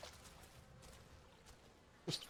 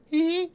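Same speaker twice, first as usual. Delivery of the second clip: squeaky giggle; 11 semitones higher than usual. Acted. Squeaky giggle after a voice-acting joke.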